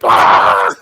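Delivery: loud, voiceless scream; sudden harsh imitation shriek; mimicked scream. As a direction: scream like a startled little robot. Acting out robot's reaction to touch.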